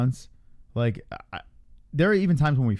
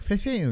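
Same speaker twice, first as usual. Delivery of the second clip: puffing noises; 9 semitones higher than usual. Retold or imitated. Belly pushing out, puffing sound.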